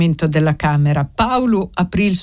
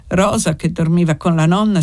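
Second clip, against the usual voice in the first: ordinary delivery, nothing marked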